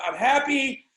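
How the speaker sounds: hammed-up happy. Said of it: "I'm acting like an, I'm in Hollywood".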